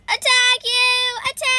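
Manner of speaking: high, loud drawn-out attack call; battle cry. Pretend. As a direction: yell the charge. Calling attack in the game.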